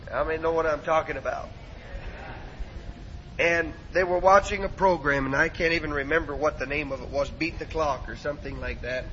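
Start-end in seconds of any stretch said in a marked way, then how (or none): none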